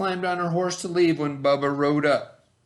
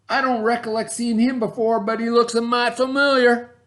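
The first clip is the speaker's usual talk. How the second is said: higher, louder puzzled voice; suspicious brother; 10 semitones higher, 5 dB louder than usual. Acted. Milton eyeing the stranger, higher and louder. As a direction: squint at the stranger as you say it. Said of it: "said Milton".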